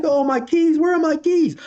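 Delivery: high, frantic squeak of a lost man; mock his panic, way up high. Driver panics over missing keys.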